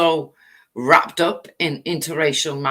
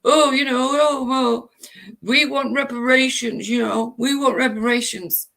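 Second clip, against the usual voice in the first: high whiny mocking wail; mock whining protester; 6 semitones higher than usual. Mocking online activists demanding reparations, in a whiny wail. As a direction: whine it like a crybaby protester. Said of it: "screaming and shouting".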